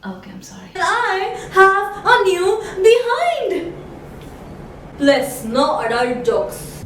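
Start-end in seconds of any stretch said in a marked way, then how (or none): 0.0-0.7 breathy whispered apology; whispered sorry. Real, not acted. 0.8-3.7 slow, rising to high; mock proud reveal.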